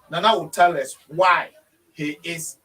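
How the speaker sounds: normal talking voice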